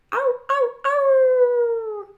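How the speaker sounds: high, loud yips into a long howl; dog howling. A narrator's reading. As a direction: howl along like an excited dog. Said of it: "howled Scooter".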